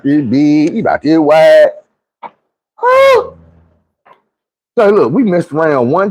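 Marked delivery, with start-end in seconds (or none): 2.8-3.3 very high squeak of disbelief; squeaky shocked huh; disbelieving huh. Retold or imitated. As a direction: shoot way up in pitch. Disbelief at size.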